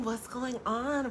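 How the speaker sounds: usual speaking voice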